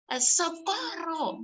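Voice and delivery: high, drawn-out mock cry; restrained play-acted distress call; mock cry for help. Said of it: "lo único que hace es gritar".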